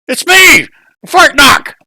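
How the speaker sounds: high, loud goblin reply. Acted. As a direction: goblin friend announces himself. Goblin answers old friend.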